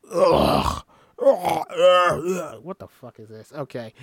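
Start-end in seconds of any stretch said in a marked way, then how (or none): 0.1-0.8 loud, much lower ugly groan; deep ugly ugh; ugly sound. Acted. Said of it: "lets go with an ugly sound". 1.2-2.6 loud, high ugly grunts; crying ugly ughs; ugly sounds. Acted. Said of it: "lets go with an ugly sound".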